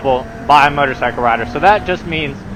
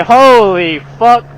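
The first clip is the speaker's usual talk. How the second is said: shocked exclaim; 6 semitones higher, 6 dB louder, slower than usual. Retold or imitated. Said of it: "you'll look down and say".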